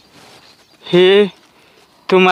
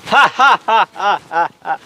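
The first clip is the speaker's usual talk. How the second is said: dazed laugh. Acted. Madman laughs after singing.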